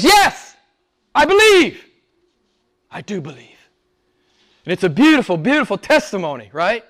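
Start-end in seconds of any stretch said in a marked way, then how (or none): 0.0-1.8 very high, loud cry of faith; impassioned, high-pitched shout; fervent profession. Acted. Acts Martha's profession of faith. 2.9-3.7 suddenly soft, sincere affirmation; very soft after the outcry; hushed profession. Acted. Repeats Martha's profession, hushed.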